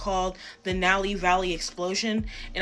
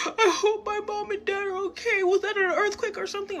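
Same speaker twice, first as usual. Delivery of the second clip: imitating sobbing friend; 13 semitones higher than usual. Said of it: "like, sobbing".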